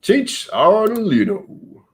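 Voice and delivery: high, slow, drawn-out announcer call. Pretend. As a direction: boom the name like a ring announcer. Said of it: "I sounded like an announcer".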